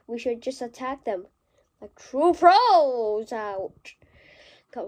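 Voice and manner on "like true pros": slow, louder put-on swagger; mock pro voice. Kid gaming boasts in a mock pro voice. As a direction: drawl it like a cocky pro.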